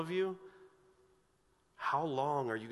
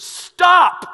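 sudden, very loud high yell; commanding shout; 22 dB louder than usual. Acted. Jesus standing up to the storm, explosive shouted command. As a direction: bellow it to halt a storm. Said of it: "he stands up and goes".

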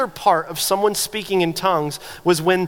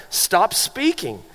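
very slow, each word set down firmly; slow firm command; much slower than usual. Real, not acted. Preacher giving Paul's order to tongue speakers, slow and firm. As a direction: lay down the command plainly and deliberately.

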